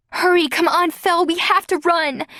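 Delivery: high urgent begging; pleading. A narrator's reading. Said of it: "Emily pleaded".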